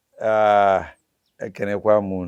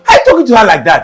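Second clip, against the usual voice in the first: higher, louder rebuke; loud scolding; 11 semitones higher, 17 dB louder, much faster than usual. Acted. Husband scolding his wife over their daughter, loud rebuke. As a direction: shout her down for insulting the daughter.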